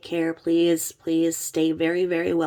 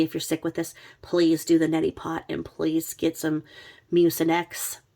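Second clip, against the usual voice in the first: ordinary talk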